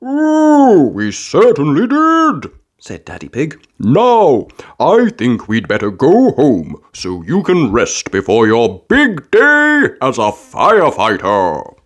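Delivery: jolly dad, slow and fond; Daddy Pig voice. A narrator's reading. Daddy Pig telling Peppa to rest, fond dad voice. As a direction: settle her down kindly.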